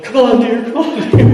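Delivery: high, coaxing wife imitation; wife's voice. Wife urges her beaten husband to leave, high coaxing. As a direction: coax him away like a fretting wife.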